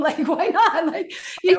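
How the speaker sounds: high, loud words through laughter; laughing protest. Real, not acted. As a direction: laugh while asking, incredulous. Laughing at a double standard.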